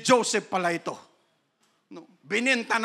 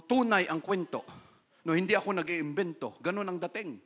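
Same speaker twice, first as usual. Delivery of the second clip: ordinary talk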